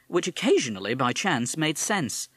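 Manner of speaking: plain reading voice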